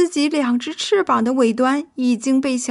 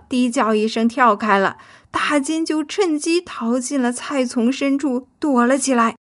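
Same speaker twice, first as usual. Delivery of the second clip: plain reading voice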